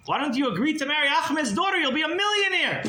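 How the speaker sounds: high, excited scolding of a fool; exasperated urging. Retold or imitated. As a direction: push him hard, voice way up. Bandit scolds captive as fool.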